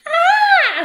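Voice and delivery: very high, loud scream of pain; bitten scream. Acted. Giant screams when the baby bites him. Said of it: "screamed the giant".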